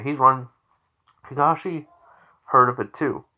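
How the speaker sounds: ordinary delivery, nothing marked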